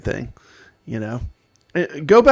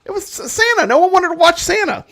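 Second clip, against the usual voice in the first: much higher, mock complaining; high mock whine; 14 semitones higher, 6 dB louder than usual. Real, not acted. Joking about Santa video.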